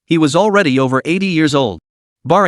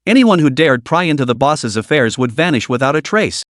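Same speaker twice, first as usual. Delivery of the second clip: usual speaking voice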